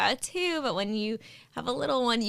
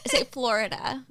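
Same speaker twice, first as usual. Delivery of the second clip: normal talking voice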